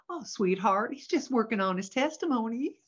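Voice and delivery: gentle imitation of a Southern drawl; sweet Southern drawl. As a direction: comfort them in sugary Southern US drawl. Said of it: "in the sweetest little Southern drawl".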